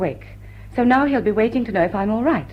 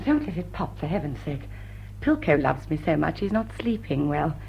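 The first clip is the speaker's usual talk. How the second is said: soft, low, hushed aside; soft low warning; 7 semitones lower, 8 dB quieter than usual. Acted. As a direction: keep your voice down so he won't wake. Warning not to wake others.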